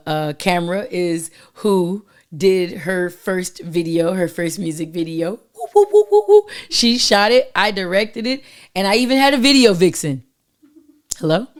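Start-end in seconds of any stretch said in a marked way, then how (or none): 5.6-6.4 high, loud woo-woo whoops; string of loud, high hoots; celebratory whooping. Real, not acted. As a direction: whoop in quick high bursts. Cheering her own video news.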